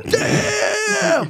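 very high stretched shout; hammed-up cartoon turtle curse; Raphael. Turtle cursing in frustration, high drawn-out mock shout. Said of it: "Like Raphael".

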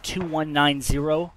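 steady, measured radio call; calm radio call for fire. Acted. Calls in fire mission.

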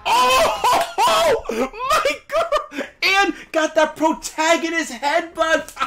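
high-pitched laughing disbelief; laughing too hard to talk straight; cracking up. Real, not acted. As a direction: squeal it through helpless laughter. Cracking up at the headbutt.

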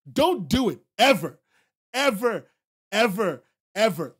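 loud, each ever hammered; repeated insistence. Real, not acted. Streamer insisting chat never signs, loud. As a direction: drive it home each time.

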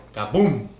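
big loud magic kaboom; kids party magician. Acted. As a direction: shout it like the trick just went off. Magic trick for a child.

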